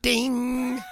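high ringing ding; mock Chinese ding. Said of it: "Chinese say".

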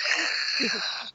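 breathy, voiceless laugh. Real, not acted. Host recalls Shatner's hilarious death, breathy laugh. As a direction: wheeze out a laugh.

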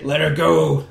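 a hint of a cool, tough hero line; mock the hero trying to sound cool; mock cool Batman. Mocking Batman trying to be all cool, lightly done. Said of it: "try and be all cool and say".

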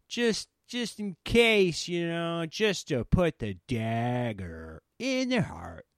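high, far dopey coach voice, slowing down at the end; mock coach voice. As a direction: mimic a reckless coach's bright idea. Mocking Nebraska coach's plan.

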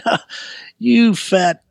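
high start, cocky jeer; retold taunt. Escaping boy looks back at the winded big man, taunting. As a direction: act out jeering at a pursuer left behind.

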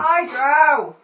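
loud cheery hello; loud greeting. Pretend. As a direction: call out brightly to them. Greeting drow in character.

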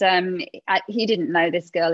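usual speaking voice

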